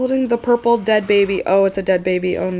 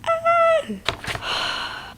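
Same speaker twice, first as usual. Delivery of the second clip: very high shriek, soft level; horrified shriek; 4 dB quieter than usual. Real, not acted. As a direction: let out a thin horrified shriek. Shriek at dead baby reveal.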